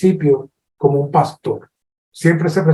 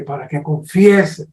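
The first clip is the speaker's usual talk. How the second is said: ordinary talk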